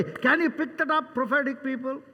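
usual speaking voice

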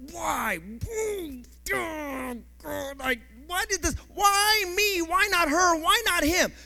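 mock the self-pitying believer; complaining believer.

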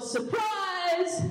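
high-pitched, held-back mock yell. Boy planning to pounce from the leaves, mock yell. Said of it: "I yelled".

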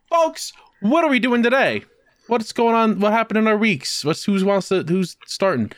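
rally the guests energetically; excited host. Acted. Host kicking off topics.

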